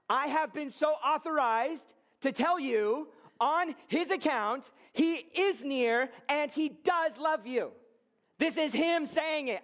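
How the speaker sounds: fervent high-pitched preacher's cry; shouting at you. Real, not acted. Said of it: "this guy named [name] sort of shouting at you".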